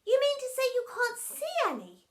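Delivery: high, quick, feigned surprise; mock innocent. A narrator's reading. Pupil teasing her teacher about invisible balls, mock innocent. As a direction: ask it high, all fake innocence. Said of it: "She asked mock innocently".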